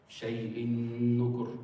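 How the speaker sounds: "Shay'in nukur" with a long held n; emphasis on the nun. Shown as a demonstration. Teacher demonstrating tanween to students, held nun in "Shay'in nukur". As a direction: slowly hum the joined n in "Shay'in nukur". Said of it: "On the nun".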